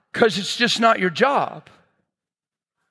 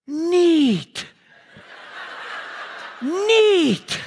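a high, drawn-out, stunned "Neat", climbing higher with each repeat; dazed "neat"; 12 semitones higher, much slower than usual. Retold or imitated. Coworker stunned after prayer, repeating a high dazed "Neat". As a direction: let each "Neat" float up higher as if in a daze. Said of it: "he must have said "neat" like five times".

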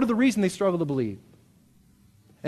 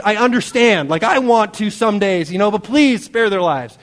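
loud, higher retold plea; raised-voice, conversational pleading; Moses; 5 semitones higher, 7 dB louder than usual. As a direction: imitate a leader begging God for mercy. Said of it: "he's crying out to God".

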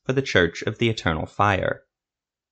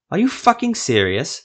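high, loud incredulous snap at his companion; angry snap; 9 semitones higher, 4 dB louder than usual. A narrator's reading. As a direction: bite the words off in disbelief. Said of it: "the sorcerer snapped".

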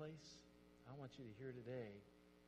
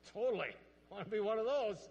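loud, high eager cry of the guys; excited shout; 8 semitones higher, 14 dB louder, faster than usual. Retold or imitated. Pastor mimics the guys shouting with excitement. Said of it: "And all the guys said".

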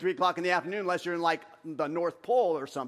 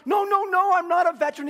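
loud, high re-enacted denial; raised-voice correction; flustered protest; 12 semitones higher, 9 dB louder than usual. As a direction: cut them off with an urgent, high protest. Protests being thanked as a veteran.

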